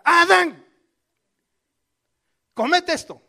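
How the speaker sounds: high, loud yell calling him over; wife yelling at her husband to eat; shouted command. Retold or imitated. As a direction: bellow it out to make him eat. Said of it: "la Biblia en el original dice que es gritar".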